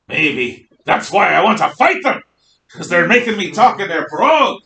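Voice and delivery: put-on Scottish accent. Teacher jokes to class in a hint of Scottish brogue. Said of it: "I'm talking like a Scot".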